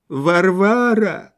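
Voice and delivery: drawn-out, high call through the nose; pleading high drawl into the nose; nasal whine. A narrator's reading. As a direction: say it through the nose, stretching it slowly. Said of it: "сказал Лоханкин в нос".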